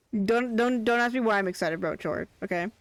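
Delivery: ordinary talk, nothing marked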